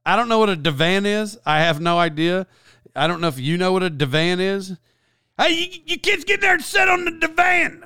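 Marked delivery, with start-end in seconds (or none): 5.4-7.8 high, shouted mock grandma call; exaggerated grandma voice. Mocking grandma shouting at the kids to sit on the divan. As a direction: ham up grandma hollering at the kids. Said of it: "my grandma didn't talk like that".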